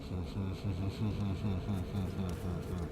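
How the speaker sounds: low, eerie evil chuckle; Jarek's creepy evil laugh. Retold or imitated. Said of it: "creepy ass laugh".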